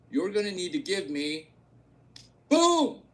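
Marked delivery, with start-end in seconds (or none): 2.5-3.0 high, loud burst on boom; punchy boom. Acted. Pitching investor hits the big number, punchy boom. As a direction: slam the word boom like a reveal. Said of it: "punchline burst".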